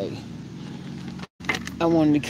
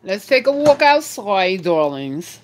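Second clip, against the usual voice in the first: put-on posh accent; slower than usual. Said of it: "Y'all hear my little accent?".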